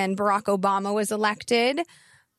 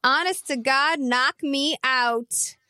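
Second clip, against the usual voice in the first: slow, stretched pop-star catchphrase; drawn-out catchphrase; 3 semitones higher, slower than usual. Retold or imitated. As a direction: draw out every word of "knock me out". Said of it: "something the kids say that [name] coined".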